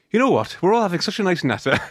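high chirpy Welsh lilt; Welsh accent. Retold or imitated. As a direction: sing it out like a cheery Cardiff local. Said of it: "in Cardiff, it'd be like".

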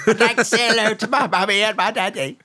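put-on squeaky high little-kid voice; exaggerated small child on a recording; posh tiny child. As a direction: mimic your childhood self, very prim and high. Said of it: "I just said".

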